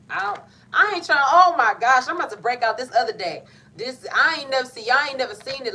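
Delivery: high, loud, bursting with excitement; excited outburst. Real, not acted. Tarot reader bursting out to viewers, loud and excited. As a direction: blurt it out, thrilled and loud.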